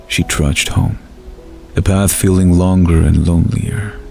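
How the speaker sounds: plain reading voice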